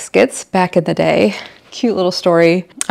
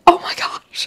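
hushed unvoiced whisper of panic; whispered dread. Mom realizing she forgot the baskets, whispering in dread. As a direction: breathe it out in sudden dread. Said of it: "And I was like".

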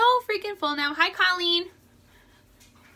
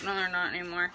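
deflated reply; 7 semitones lower, 7 dB quieter than usual. Acted. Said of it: "now I'm like".

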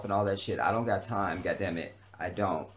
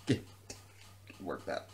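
laughing at himself; 8 semitones lower, 12 dB quieter, slower than usual. Real, not acted. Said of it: "I'm getting worked up".

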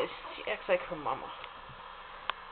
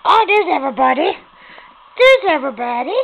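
excited greeting; 5 semitones higher, 11 dB louder than usual. Real, not acted. Greeting the puppies.

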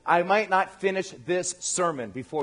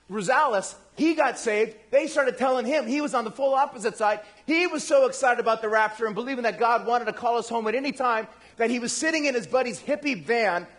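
normal talking voice

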